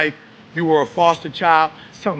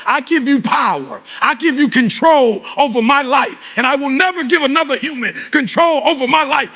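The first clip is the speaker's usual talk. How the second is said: preaching at full force; 10 semitones higher than usual. Acted. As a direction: whip the room into a chant. Fires up the crowd.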